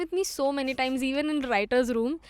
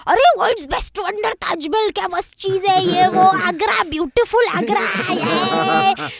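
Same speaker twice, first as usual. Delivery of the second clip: shouting, pitched-up sales pitch; loud hyped tout; 6 semitones higher, 8 dB louder than usual. Retold or imitated. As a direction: hype it up like a pushy driver. Taxi driver hyping Agra loudly.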